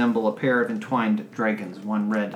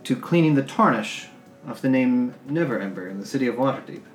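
formal noble lady; 3 semitones higher, 4 dB louder than usual. Acted. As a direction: pronounce it like a lady granting a favour. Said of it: "Turns to Rainier and says".

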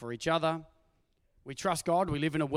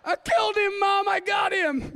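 put-on triumphant kid shout; 12 semitones higher, 8 dB louder than usual. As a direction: yell it like a proud five-year-old who won the fight. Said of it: "He's like".

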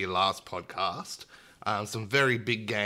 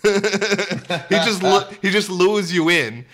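laughing; 8 semitones higher, 10 dB louder, slower than usual. Real, not acted. Laughing about Tom Nook.

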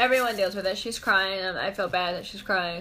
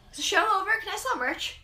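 high, small retold voice; light, high mock-innocent query; innocent question; 10 semitones higher, faster than usual. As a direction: ask hopefully, pitched way up. Retold dazed question about merch.

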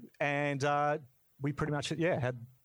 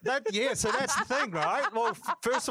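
laughing; 4 dB louder than usual. Real, not acted. Laughing while telling the twist.

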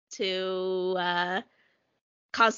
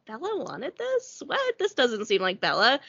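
baffled disbelief. Acted. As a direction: react like a puzzled friend, pitch leaping up. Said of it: "they're gonna be like".

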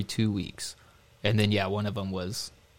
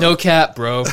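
trying too hard cool; 5 semitones higher, 8 dB louder than usual. Pretend. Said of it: "Did I get a little too hip on you?".

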